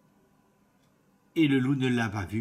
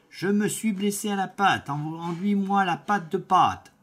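wolf voice; 7 semitones higher, 4 dB louder than usual. Acted. Wolf asks the baker.

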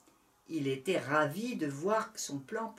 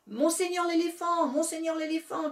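high, loud breathless calling out; 9 semitones higher, 4 dB louder than usual. Acted. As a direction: call out, out of breath from running. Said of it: "cria le petit lapin tout essoufflé".

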